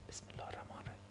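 unvoiced whisper of بسم الله, imitating a timid speaker. Mimicking the shy speaker who hides the bismillah, whispered. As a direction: hush it as if ashamed to be heard.

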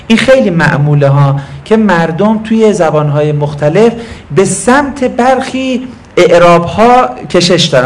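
usual speaking voice

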